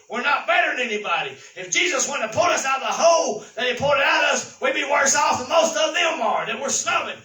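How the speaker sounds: fiery revival preaching; full-force preaching. Acted. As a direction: drive the point home to the church.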